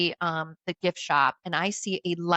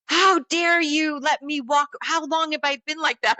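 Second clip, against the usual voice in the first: raised, indignant mock yell; retold angry scream; 8 semitones higher, 4 dB louder than usual. Storyteller acts out angry man scolding her, indignant yelling. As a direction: imitate him angrily, higher and louder. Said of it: "screaming at me".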